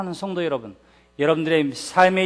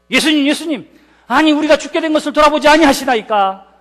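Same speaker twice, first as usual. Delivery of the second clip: raised, higher, urgent complaint to a sleeping master; resentful cry; 8 semitones higher, 7 dB louder than usual. Retold or imitated. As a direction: plead loudly, half blaming. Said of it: "원망하듯이 소리쳤을 때에". (원망하듯 외치는 제자들)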